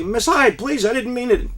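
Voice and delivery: high, loud begging; pleading child. Retold or imitated. Boy begging Miss Hyde to stop, high and pleading. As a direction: beg the teacher to stop. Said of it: "I was starting to say".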